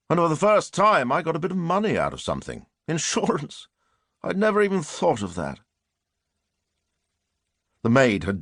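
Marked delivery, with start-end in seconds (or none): 0.1-5.6 higher, pleased with himself; gleeful. A narrator's reading. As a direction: let the secret delight lift the voice. Murderer's gleeful inner thought.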